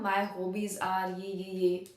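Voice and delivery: ordinary talk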